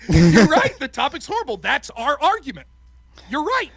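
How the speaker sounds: laughing through a smug agreement with the other team; laughing mock agreement. Debate team laughing as they agree with opponents. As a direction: crack up while cheerfully conceding the point. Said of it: "the negative stands up and goes".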